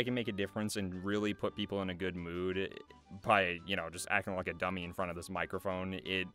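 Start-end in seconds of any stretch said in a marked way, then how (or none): none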